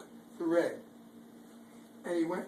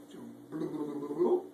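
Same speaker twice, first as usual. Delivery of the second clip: usual speaking voice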